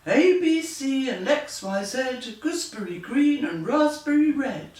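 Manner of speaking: puffed up, chant the spell; magic spell. Acted. Genie puffs himself up, lightly voiced spell chant. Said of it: "The genie puffed himself up and said".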